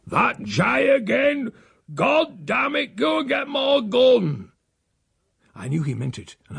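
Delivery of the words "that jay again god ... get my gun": much higher, slow angry shout; raised voice. Acted. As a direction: bellow for the gun, drawn out. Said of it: "he had raised his voice".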